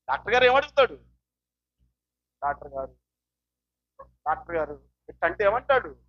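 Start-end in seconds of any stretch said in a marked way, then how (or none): 2.4-4.9 low, faint, drowsy call; mock sleepy. Patient calling the doctor as if come to sleep, mock sleepy. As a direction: act a patient nodding off while calling the doctor. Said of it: "నువ్వు నిద్రపోవడానికి వచ్చావా".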